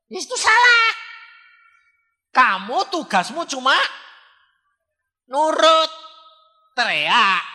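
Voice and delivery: preacher's full-force shout, high. Acted. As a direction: bellow the rebuke at her. Preacher shouting at mute woman. (teriakan keras penuh semangat)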